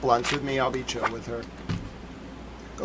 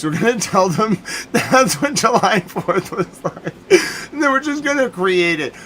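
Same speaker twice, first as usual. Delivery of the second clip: laughing; 7 semitones higher, 7 dB louder than usual. Real, not acted. Laughing at his own joke.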